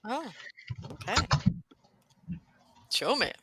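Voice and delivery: usual speaking voice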